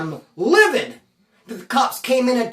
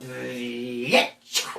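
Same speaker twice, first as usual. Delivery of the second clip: low, drawn-out contemptuous mutter; utter disrespect; 8 semitones lower, 6 dB quieter than usual. Retold or imitated. Snubbing the cellmate who returned the TV, full of contempt. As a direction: say it with all the scorn you can muster. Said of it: "with all the disrespect I can muster up".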